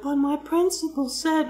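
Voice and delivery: high mock whine of a complaining teacher; mocking whiny teacher. Mocking the teacher who wants her computer, high whiny voice. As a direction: squeak it out like a petty teacher.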